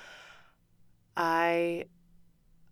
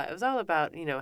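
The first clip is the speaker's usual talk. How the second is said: normal talking voice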